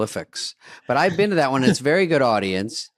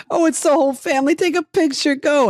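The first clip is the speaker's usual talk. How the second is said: much higher, excited mom voice; mom at dinner; 3 dB louder than usual. Retold or imitated. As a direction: squeal it like an eager mom grabbing a photo. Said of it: "She goes".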